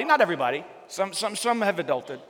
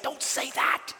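hushed warning whisper; 7 semitones higher, 6 dB quieter, much slower than usual. Retold or imitated. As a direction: whisper it like hushing a child. Parent hushing a kid.

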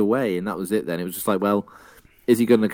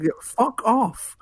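slow, high, drawn-out brush-off; dismissive; 10 semitones higher, much slower than usual. Retold or imitated. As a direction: toss it off with contempt. Retold dismissive quote from driver.

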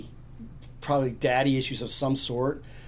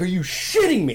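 louder, higher mock indignation; pissed-off roommate; 5 semitones higher, 5 dB louder than usual. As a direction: imitate exasperated disbelief. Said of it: "he's pissed".

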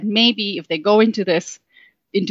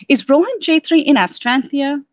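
raised, higher call to the wall; a louder, higher question; asked more loudly; 7 semitones higher than usual. Acted. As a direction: push it out louder, up in pitch. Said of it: "Leela asked more loudly".

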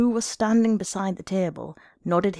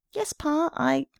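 put-on little boy; 9 semitones higher, slower than usual. A narrator's reading. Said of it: "A little boy came running down".

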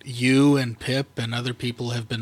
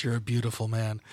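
normal talking voice

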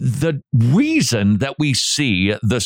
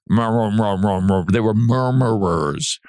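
murmurers drawn out very slowly; slow stress on murmurers; 3 semitones lower, much slower than usual. Real, not acted. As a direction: lean heavily into murmurers. Emphatic drawn-out condemnation.

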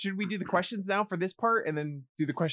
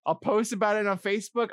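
ordinary talk, nothing marked

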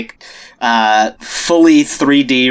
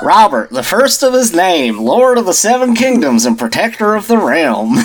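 put-on Buster Scruggs Southern accent; 5 semitones higher than usual. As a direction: proclaim the king's titles like a Southern cowboy. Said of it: "a Buster Scruggs Southern accent".

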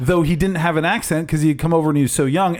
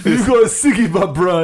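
laughing put-on Scottish accent; playful fake Scottish accent; mock accent insult; 3 semitones higher, 3 dB louder than usual. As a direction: echo the silly accent while laughing.

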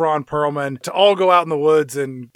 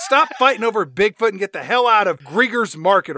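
higher-pitched mock scolding; Judy Greer; 5 semitones higher than usual. Store worker throwing out squabbling old ladies, high mock scolding. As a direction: order them out in an exasperated raised pitch. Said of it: "Judy Greer comes over and she's like".

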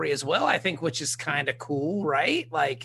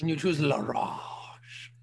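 slow French drawl; French accent; 3 semitones lower, slower than usual. Acted. French waiter scorning ranch, slow French accent. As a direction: savour the words in mock French.

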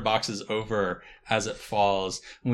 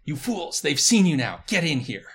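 higher, loud urgent hiss; urgent villain voice; 6 semitones higher, 4 dB louder than usual. Acted. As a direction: rush them inside, commanding. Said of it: "Professor Shadow's voice saying".

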